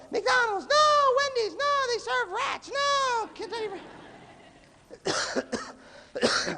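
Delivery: high-pitched squabbling yell; hammed-up high shouted protests; bickering shout. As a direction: shriek it like kids fighting over where to eat. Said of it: "there's a big fight where".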